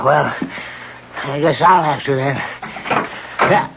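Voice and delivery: ordinary delivery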